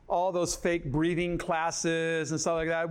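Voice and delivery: ordinary talk, nothing marked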